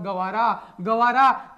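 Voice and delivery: slow, spelled-out Gavara; slow drawn-out Gavara. Shown as a demonstration. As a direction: stretch out Gavara, Gavara slowly, each syllable long. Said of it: "अरे गवार है रे भाई साहब".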